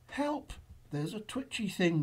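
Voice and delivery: high, small cry for help; gasped alarm. A narrator's reading. Boo notices a mouse, mildly gasped alarm. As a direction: squeak out a mild alarm. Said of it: "Boo gasped".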